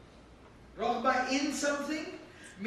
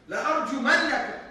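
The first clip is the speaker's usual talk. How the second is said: rapid, louder threat; emphatic threat; 2 semitones higher, 6 dB louder, much faster than usual. Acted. Father vowing to stone his son, fast and loud. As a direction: bite it out fast and hard. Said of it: "two degrees of emphasis".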